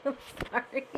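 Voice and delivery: real laughter breaking through an apology; cracking up mid-story; laughing. Real, not acted. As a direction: laugh helplessly through the words. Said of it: "I'm crying".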